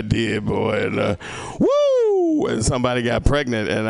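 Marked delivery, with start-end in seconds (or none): 1.6-2.5 whoop. Acted. Reliving wild pill period.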